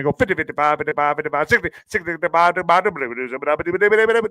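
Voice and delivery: fast auctioneer chant, rattled off. Shown as a demonstration. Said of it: "I need to be like an auctioneer".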